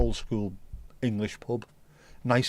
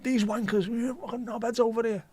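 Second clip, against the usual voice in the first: high, put-on ranting voice; mock rant; 10 semitones higher than usual. Ranting at tourists from a castle, high mock voice. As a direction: rant at them in a silly high voice. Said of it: "me ranting in a car".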